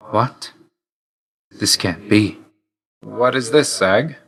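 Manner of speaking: taken aback, pitch lifting on the question; disbelieving. Acted. To Zag about something unexpected, stunned disbelief.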